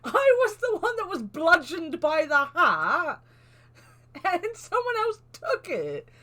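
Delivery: high-pitched protest, half laughing; indignant. Real, not acted. Streamer complaining about losing the hat, indignant. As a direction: protest it high, drawing out the grievance.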